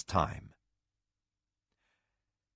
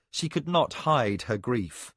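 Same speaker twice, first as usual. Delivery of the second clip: usual speaking voice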